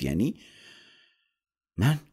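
halting, frightened question climbing high; scared, hesitant disbelief; trembling voice. A narrator's reading. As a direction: stammer it out fearfully, with long pauses. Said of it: "با صدای لرزون گفت".

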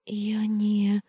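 high, breathy broken-off start; high hesitant whisper. A narrator's reading. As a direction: start to object, then falter. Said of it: "начала я неуверенно".

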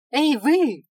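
loud, high call; gang leader shouting. A narrator's reading. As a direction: shout at strangers. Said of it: "крикнул главарь".

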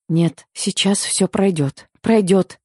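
slow, shaky reassurance to herself; self-soothing repetition. Acted. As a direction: talk yourself down from panic. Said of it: "повторяла я, уговаривая свой мозг".

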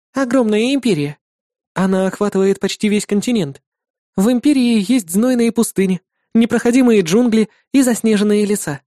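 put-on high bright girlish voice; young woman voice. A narrator's reading. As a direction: voice the demon girl cheerfully. Irda tells about the empire.

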